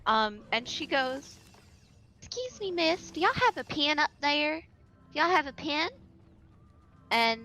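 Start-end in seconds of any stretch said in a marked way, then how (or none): 2.2-5.9 higher-pitched imitation of a Southern mother; thick Southern twang, polite request; mom's thick Southern accent. Imitating mom at the post office, thick Southern accent. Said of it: "my mom has a very thick".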